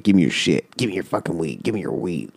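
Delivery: low, softened growly demand in imitation; retold low-key demand; demanding homeless man. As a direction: act out a lightly voiced street mugger, quiet and low. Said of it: "literally, like, demanding that we hand over our weed".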